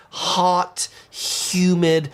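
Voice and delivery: ordinary delivery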